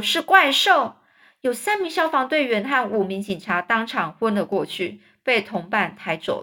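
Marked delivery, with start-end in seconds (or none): 0.0-1.0 loud raised cry; high alarmed shout. A narrator's reading. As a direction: yell it out. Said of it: "接下来说".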